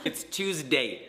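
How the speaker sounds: stretched slowly; slow and drawn out. Shown as a demonstration. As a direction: drag each word out. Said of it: "Did you hear it?".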